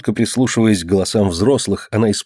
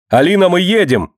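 high, raised call down the hall; 9 semitones higher, 4 dB louder than usual. A narrator's reading. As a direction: shout to her that it's time. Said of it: "закричала она". (высокий окрик)

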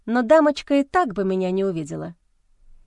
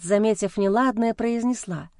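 ordinary delivery

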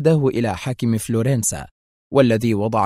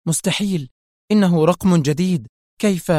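higher-pitched, startled disbelief; astonished; 6 semitones higher than usual. A narrator's reading. Woman learns Cairo has the new number, astonished. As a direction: blurt it out, pitch jumping in amazement. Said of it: "ارتفع حاجباها الجميلان مع اتساع عينيها".

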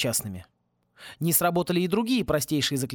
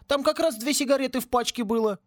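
much higher whiny plea; whining; 9 semitones higher than usual. A narrator's reading. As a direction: whimper it like a caught kid. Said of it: "подскулил брату Тинки". (скулит, как пойманный мальчишка)

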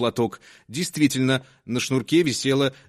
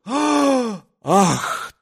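gasp; 9 semitones higher than usual. Acted. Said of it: "ахнула и публика".

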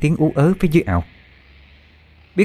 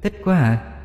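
put-on eerie ghost girl voice; 5 semitones higher, 6 dB quieter than usual. A narrator's reading. Ghost girl claims him.

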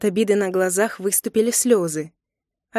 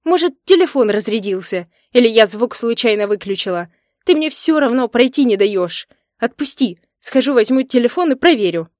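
loud, high exasperated protest; heated excuses; 4 semitones higher, 7 dB louder than usual. A narrator's reading. Wife defending herself to husband, heated and exasperated. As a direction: argue back louder, demanding release. Wife argues back, still angry.

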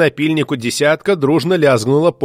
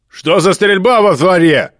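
loud, slow, higher bark; angry bark; 5 semitones higher, 5 dB louder than usual. Acted. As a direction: roar down from the tower. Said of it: "злобно рявкнул Кувалда".

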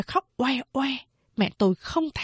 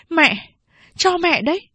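raised, earnest daughter's plea; pleading daughter; 8 semitones higher, 4 dB louder than usual. A narrator's reading. Daughter pushing her baby on mother, raised voice.

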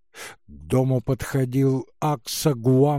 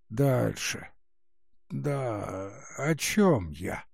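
тихий шамкающий старушечий голос; шамкать, как беззубая старуха; шамкающая старуха; 3 semitones lower, 5 dB quieter, slower than usual. Acted. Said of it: "шамкала старуха". (старческое шамканье)

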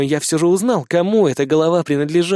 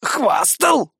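high, mock-outraged squawk; feigned indignation; 9 semitones higher than usual. A narrator's reading. Iggy feigns outrage at the accusation, high mock indignation. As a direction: fake offense, pitched up. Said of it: "делано возмутился Игги".

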